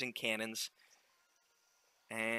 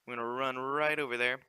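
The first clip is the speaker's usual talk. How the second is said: ordinary delivery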